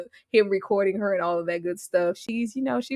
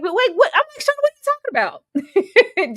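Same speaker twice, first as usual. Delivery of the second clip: very high, louder disbelief; incredulous high-pitched questioning; baffled; 13 semitones higher, 4 dB louder, much faster than usual. Retold or imitated. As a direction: squeal it in confusion. Acts out baffled reaction to Shauna.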